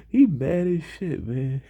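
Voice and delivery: high-pitched teasing jeer; mocking taunt. Classmate who just kept saying it, high mocking taunt.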